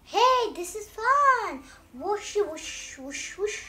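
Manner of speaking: high, slow, loud delight. Acted. Pigeon discovering the bath is fun, high slow delight. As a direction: squeal with glee.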